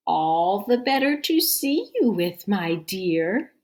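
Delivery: menacing sweetness; wolf as grandma. Acted.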